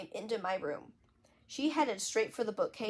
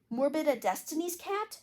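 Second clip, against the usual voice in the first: shriek; 7 semitones higher than usual. Acted. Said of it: "shrieked David Michael".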